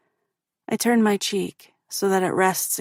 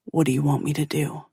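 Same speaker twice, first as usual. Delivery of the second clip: low male voice; 4 semitones lower, faster than usual. A narrator's reading.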